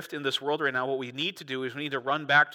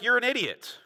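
high, scornful put-down; dismissive mock retort; 7 semitones higher than usual. Pharisees to returning soldiers, dismissive. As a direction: cut them off with a raised-pitch insult.

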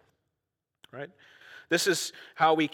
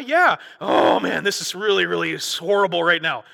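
swinging psalmist; 3 semitones higher, 6 dB louder than usual. Retold or imitated. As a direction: swing from hope to despair.

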